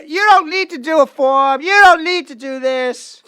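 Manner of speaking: put-on high, shouted ignorant Southern accent; mock Southern-sounding accent. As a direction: belt it out in a hammed-up Southern twang. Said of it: "Sounds Southern".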